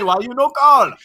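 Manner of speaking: high and loud, stress on call; Mama George impression. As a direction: scold him for not calling. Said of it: "That entire impersonation".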